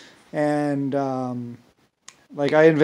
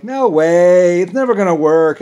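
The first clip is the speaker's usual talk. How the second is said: scoffing, louder and higher dismissal; mock doubters; 5 semitones higher, 12 dB louder than usual. Imitating critics who said it would never work, scoffing. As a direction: sneer it like the naysayers did.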